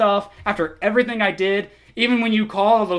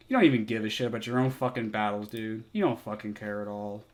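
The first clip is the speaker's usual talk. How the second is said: quiet disgust; 9 semitones lower, 9 dB quieter than usual. Real, not acted. Deflated tired anger.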